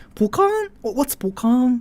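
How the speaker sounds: high, puzzled "ポカーン?"; confused foreigner. Retold or imitated. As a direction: mimic a baffled non-Japanese. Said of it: "you would go like".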